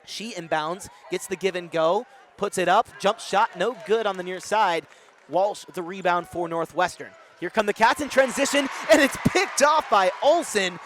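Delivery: energetic basketball commentary, rising to excited calls; live play-by-play. Real, not acted. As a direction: call the game live, surging on the big shot.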